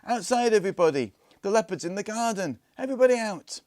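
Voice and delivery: very high, loud alarm shout; calling out. A narrator's reading. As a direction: raise the whole house. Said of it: "calling out".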